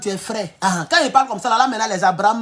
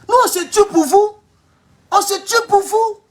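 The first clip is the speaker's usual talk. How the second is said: impassioned cry; 12 semitones higher, 4 dB louder than usual. Real, not acted. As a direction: cry it out for your people. Dramatic plea for the country.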